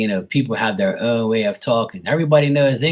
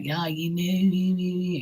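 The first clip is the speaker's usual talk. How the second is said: long-drawn, high mock Bermudian talk; mock hardcore Bermudian; 6 semitones higher, 4 dB quieter, much slower than usual. Local mocking people who talk hardcore, exaggerated Bermudian accent. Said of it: "hardcore like that".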